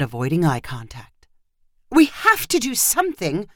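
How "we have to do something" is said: raised-pitch urgent call. A narrator's reading. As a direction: call across to someone, higher pitched. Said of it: "called Marissa".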